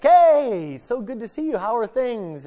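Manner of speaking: high, loud retold cheery hello; bright party hello; cheery greeting. Greeting a baseball dad at Christmas party, high cheery hello. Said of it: "and I was like".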